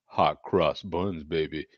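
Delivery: low, laid-back put-on voice; lightly voiced low character; put-on "baby" voice. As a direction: drop it low for a smooth shtick. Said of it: "You do the voice".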